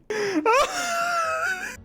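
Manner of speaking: high, shrill burst of laughter; a sudden high-pitched cackle of surprise; shrieking laugh. Real, not acted. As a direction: explode into a squealing laugh. Suddenly bursts out mid-game.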